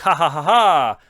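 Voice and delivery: evil villain cackle; demonic laugh. Acted. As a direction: laugh like a menacing vampire. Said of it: "et fait un rire démoniaque". (rire démoniaque)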